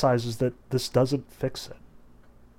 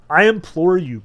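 higher, louder plea; plaintive begging; 4 semitones higher, 8 dB louder than usual. A narrator's reading. Margarita implores the master, begging plaintively. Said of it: "Margarita begged plaintively".

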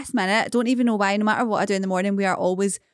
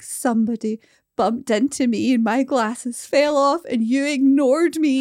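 put-on slow, higher upset kid voice; whiny child; 4 semitones higher, slower than usual. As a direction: whine it like a hurt little boy. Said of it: "he was like".

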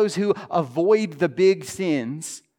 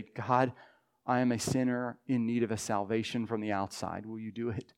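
humble confession; 6 semitones lower, 9 dB quieter than usual. Retold or imitated.

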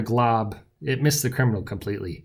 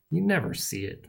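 soft, higher-pitched grumble; grumbling; 6 semitones higher, 5 dB quieter than usual. A narrator's reading. Sidekick complains to an inattentive hero, grumbling. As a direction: complain half to yourself. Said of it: "I grumbled".

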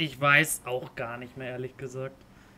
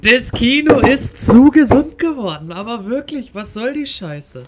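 escalating comedy rant; 8 semitones higher, 11 dB louder than usual. Real, not acted. Joins the rant.

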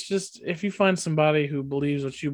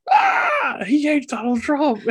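high, loud hysterical yell; mock outraged scream; 11 semitones higher, 6 dB louder, slower than usual. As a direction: shriek it like an outraged mob, hammed up. Mimicking Fauci's outraged critics.

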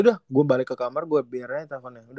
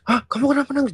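startled; 9 semitones higher, 5 dB louder, faster than usual. Retold or imitated. Girlfriend woken by crying call.

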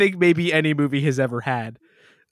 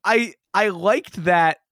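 laughing; 5 semitones higher than usual. Real, not acted. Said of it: "laughing disbelief".

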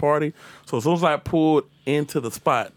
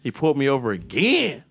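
usual speaking voice

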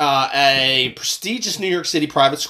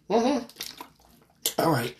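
ordinary talk, nothing marked

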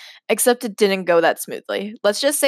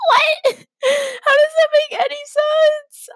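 very high squeal through helpless laughter; incredulous laughing; 4 dB louder than usual. Real, not acted. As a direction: squeak it out, cracking up in disbelief. Cracking up at absurd story.

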